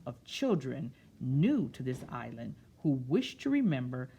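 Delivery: put-on slow, expressive read-aloud to children; storybook reading. A narrator's reading. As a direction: read it like story time, savouring each word. Reads picture book aloud.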